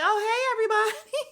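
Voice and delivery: bubbly entrance. Retold or imitated. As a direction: burst in laughing with delight. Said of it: "And she's like".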